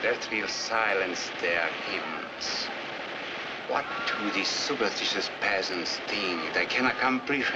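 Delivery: grim contempt. Acted. Premier scorns hymns after gunfire.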